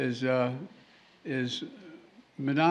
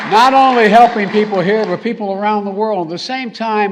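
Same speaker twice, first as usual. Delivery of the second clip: rousing; 6 semitones higher, 11 dB louder than usual. Real, not acted. Rousing the crowd.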